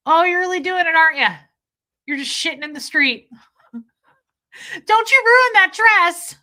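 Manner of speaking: high, loud hammed-up scolding; mock indignant movie quote. Quoting Bridesmaids to Kat, high hammed-up scolding. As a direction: ham up the shocked bridesmaid.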